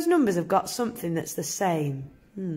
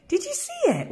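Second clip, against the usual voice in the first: excited reveal. Acted. Teacher excited reveal to pupils.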